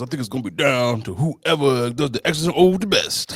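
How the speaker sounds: mock sports analyst voice; relaxed sports commentary. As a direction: play a pundit stating the obvious. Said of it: "Look at you sounding like a sports analyst".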